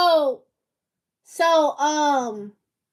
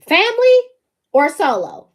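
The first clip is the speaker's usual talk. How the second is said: emphatic high family; 5 semitones higher, 4 dB louder than usual. Real, not acted. Posing the either-or choice.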